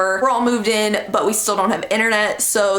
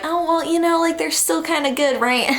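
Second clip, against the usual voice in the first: high, hopeful mock excuse; imitating her own forgiving self, pitched up; hopeful excusing; 8 semitones higher than usual. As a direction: make excuses for them in a high little voice. Excusing people who treat her badly.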